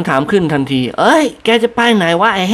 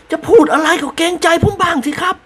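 high voiced lord mildly scolding; a touch of a peeved husband; 14 semitones higher than usual. A narrator's reading. Bald lord scolds his wife for the joke. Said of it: "เอ็ดตะโล".

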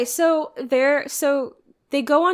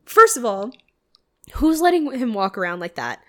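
normal talking voice

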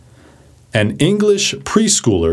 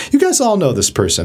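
usual speaking voice

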